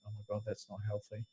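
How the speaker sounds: quick, soft worried mutter; worried aside. Retold or imitated. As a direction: rush it under your breath, alarmed. Mother's worried reply to son.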